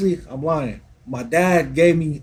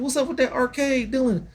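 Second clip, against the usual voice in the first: higher-pitched questioning of a son; lightly acted pushback, pitched up; retold dad's challenge; 9 semitones higher, 3 dB quieter than usual. Dad questioning his son's spending, a higher retold challenge. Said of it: "I was like".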